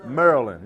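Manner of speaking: "Maryland" as "Mer-lin", two syllables; clipped local "Maryland"; local pronunciation. Shown as a demonstration. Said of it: "What did I call it?".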